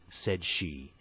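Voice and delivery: usual speaking voice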